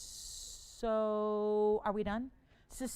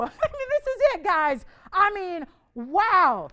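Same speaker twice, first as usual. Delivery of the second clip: laughing disbelief; 6 semitones higher, 11 dB louder, slower than usual. Real, not acted. Laughing at slow bidding.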